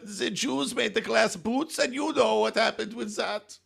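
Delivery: high, slow, put-on German accent; mock German lament. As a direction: wail it like a heartbroken German barman, slowly. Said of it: "I don't know what accent that was".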